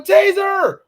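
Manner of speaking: high mock scream of taser; shrill imitated cry of taser. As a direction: yell it like a panicked cop. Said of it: "And she screamed".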